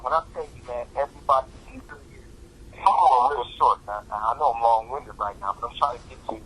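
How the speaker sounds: ordinary talk, nothing marked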